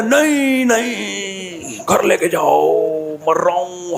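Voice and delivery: a high cry on नहीं, then a slow, drawn-out plea; feigned dying plea. Retold or imitated. Rider faking injury begs to go home, slow and pained. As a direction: beg weakly and slowly, like you're barely alive.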